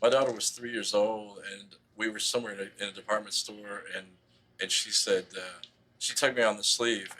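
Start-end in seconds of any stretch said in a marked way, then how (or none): none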